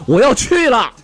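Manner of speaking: high-pitched comic yell; imitated frantic shout; loud cry. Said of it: "就哇家一大声就喊".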